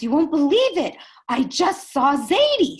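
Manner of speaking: put-on high, loud child bursting with news; excited grandson voice in a story; acted urgent excitement. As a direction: blurt out big news, stretching the end.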